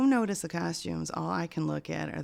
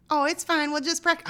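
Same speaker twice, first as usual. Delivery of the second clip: high, loud acted reply; hammed-up encouragement, pitched way up; mock breezy reassurance; 11 semitones higher, 8 dB louder than usual. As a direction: brush it off in a raised voice. Acted reply, playful quote.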